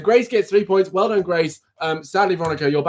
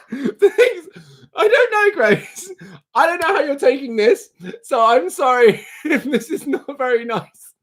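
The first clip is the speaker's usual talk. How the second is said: laughing apology; 9 semitones higher than usual. Real, not acted. Apologizing while still laughing.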